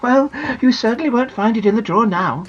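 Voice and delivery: a lightly voiced mother; mother's voice. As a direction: tease her daughter lightly. Mummy speaks to Janet.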